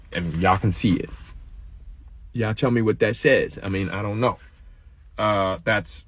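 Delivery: ordinary delivery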